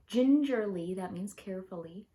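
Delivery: ordinary delivery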